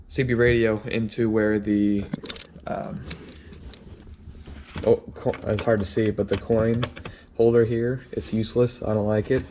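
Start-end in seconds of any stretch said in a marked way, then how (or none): none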